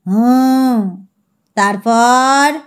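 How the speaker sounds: put-on half-asleep old man prodding; drowsy old man. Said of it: "এক একবার চমক আসে আর বলেন".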